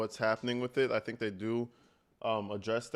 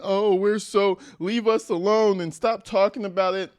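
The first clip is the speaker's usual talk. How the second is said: higher, louder mimicry of an exasperated family; mockingly plead, raised pitch and volume; mock indignant plea; 7 semitones higher, 5 dB louder than usual. Commentator imitating the family at the press conference, mock indignant.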